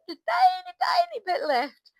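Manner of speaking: very high, loud, drawn-out squeak; exaggerated teeny-tiny squeak; squeaky tiny voice. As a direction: squeal it slowly to show how tiny. Playfully showing how little money remained.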